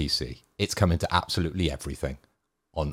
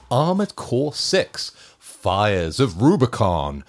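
hyped title; 4 semitones higher, 6 dB louder, much slower than usual. Acted. Hyping the game title.

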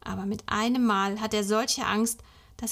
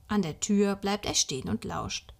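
usual speaking voice

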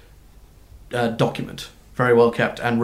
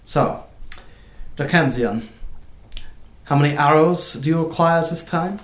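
brisk clerk voice; 5 semitones higher than usual. Acted.

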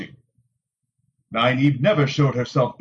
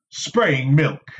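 ordinary delivery, nothing marked

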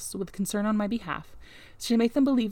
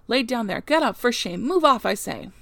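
louder, emphatic shooing away; indignant scolding; 3 semitones higher, 6 dB louder than usual. A narrator's reading. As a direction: order the beggar off the doorstep. Servant scolds the beggar woman away.

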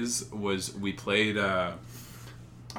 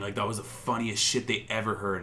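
ordinary talk, nothing marked

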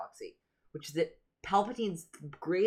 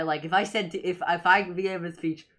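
ordinary delivery

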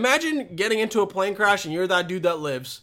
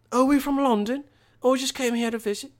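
lightly imitated English accent, higher pitched; mock English accent; 5 semitones higher, faster than usual. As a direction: play the London visitor with an English accent. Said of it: "her English accent was just exactly like mine".

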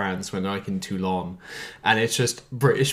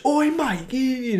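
high-pitched caricature of a British lad; hammed-up, high "oi, mate" voice; mock British bloke; slower than usual. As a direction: call out like a cartoon Brit. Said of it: "How do you know the British?".

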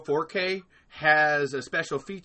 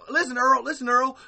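higher-pitched Southern character voice; pitched-up impersonation of a Southern buddy; Val impression; 7 semitones higher than usual. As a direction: put on a Southern twang, calling for attention. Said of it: "'cause Val talks like this".

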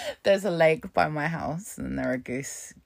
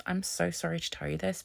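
usual speaking voice

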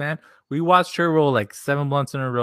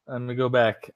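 ordinary delivery

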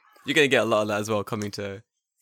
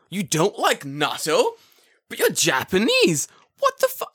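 higher, slow incredulous question; mock disbelief; 8 semitones higher, 4 dB louder, slower than usual. Teasing a Japanese guy about natto with slow, high mock shock. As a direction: fake outrage building to a squeal.

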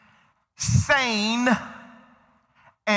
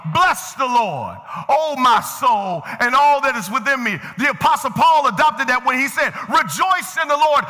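preaching; 5 semitones higher, 3 dB louder than usual. Real, not acted. Preacher proclaiming the psalm and Paul to the congregation, fervent preaching. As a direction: proclaim it, leaning long on the key words.

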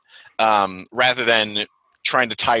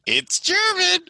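put-on German accent; slower than usual. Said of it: "I can do my German accent".

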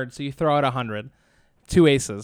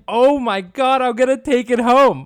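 higher, louder, fired-up hope; excited gambler; 7 semitones higher, 4 dB louder than usual. Retold or imitated. Bettor at the blackjack table, imitated with excitement. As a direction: sell the rush of a sure win. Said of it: "And you're like".

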